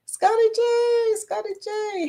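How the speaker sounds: high sing-song name call; playful greeting. Real, not acted.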